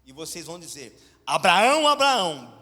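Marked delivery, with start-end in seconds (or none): 1.2-2.6 "Abraão, Abraão" called out much louder and firm; strong and firm. Shown as a demonstration. Preacher modelling the call for the congregation, strong and firm. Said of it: "bem forte e bem firme".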